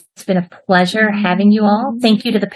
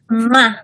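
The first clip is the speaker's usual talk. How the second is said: air kiss. Real, not acted. Blowing a kiss goodbye.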